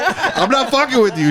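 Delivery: high-pitched, laughing protest; cracking up while insisting; laughing insistence. Real, not acted. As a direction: swear it's true through real laughter. Swears story is true, amid laughter.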